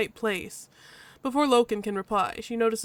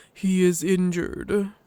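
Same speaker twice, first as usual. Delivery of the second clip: slow, louder, weighty denial; gruff husky Loken; 2 semitones lower, 5 dB louder, much slower than usual. Acted. Loken answers the badger, gruff and firm. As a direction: answer slowly and firmly.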